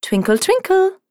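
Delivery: high tinkling little star; sparkly tinkling star voice. Acted. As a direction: chime it like a twinkling star. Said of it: "said the second".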